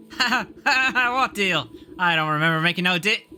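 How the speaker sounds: high scoffing laugh, then cocky denial; mocking ragamuffin boy. Boy denying the promised trade, mocking. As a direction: laugh it off as a cheeky urchin.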